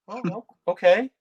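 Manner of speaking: high-pitched, uncertain little okay; sheepish okay. Retold or imitated. Nervous reply to wrestler's brush-off.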